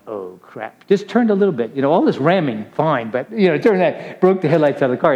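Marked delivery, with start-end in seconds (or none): 0.1-0.8 low, hushed mock dismay; quiet dismay. Teenager seeing the headlights he just smashed, quietly dismayed. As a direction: drop it down to a small, sinking aside.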